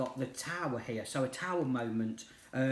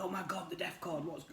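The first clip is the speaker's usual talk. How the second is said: mock freak-out; 4 semitones higher, 5 dB quieter than usual. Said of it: "some people still out there freak out".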